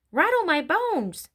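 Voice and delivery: high, slow spooky wail; spooky parrot voice. A narrator's reading. Parrot shows off for the children, imitating a spooky voice. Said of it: "wonderful imitation of a spooky voice".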